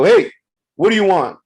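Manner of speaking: retold shout to stop someone; sharp challenge. Confronts man approaching his wife.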